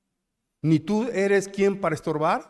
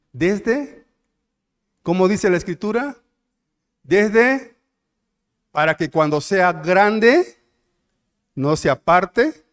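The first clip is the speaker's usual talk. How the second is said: preaching crescendo; 5 semitones higher, 9 dB louder, slower than usual. Real, not acted. Preacher drives home a verse.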